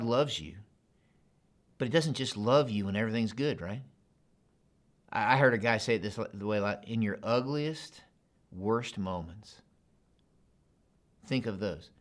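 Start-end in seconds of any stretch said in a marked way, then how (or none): none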